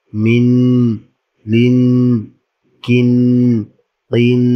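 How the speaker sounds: each syllable, like "মিন", held long on its nasal ending; ghunnah drill. Shown as a demonstration. Teacher leading learners through the chart, nasal hum held on "নিং". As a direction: hum out the final n of syllables like "ডিং". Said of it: "গুণা করতে হবে".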